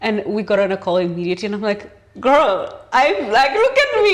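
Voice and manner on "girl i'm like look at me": much higher and louder, squealing with delight; gleeful bragging. Retold or imitated. Bragging to her friend on a call, high and gleeful. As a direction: crow it proudly, voice climbing.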